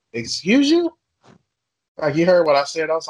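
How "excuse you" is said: sharply higher, offended disbelief; indignant excuse you. Retold or imitated. Retelling the shopper confrontation, high offended comeback. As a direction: snap back, insulted and incredulous. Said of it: "I was like".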